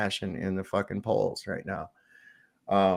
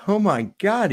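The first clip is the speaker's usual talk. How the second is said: higher, louder amazed bartender; 9 semitones higher, 4 dB louder than usual. Acted. Bartender reacts to the tiny pianist, amazed. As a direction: gasp it out like a stunned barman. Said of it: "The bartender says".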